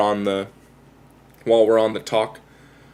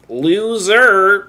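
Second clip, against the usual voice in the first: loud, high drawn-out call; booming creature cry; king jellyfish call; 8 semitones higher, 6 dB louder than usual. Retold or imitated.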